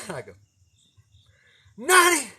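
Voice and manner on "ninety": high, loud incredulous call; father shouting in disbelief; shouted guess. Retold or imitated. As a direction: yell the number at the boy.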